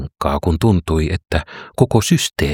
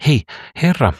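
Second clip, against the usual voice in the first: calling out; 8 semitones higher than usual. Acted. Woman calling out to Jesus.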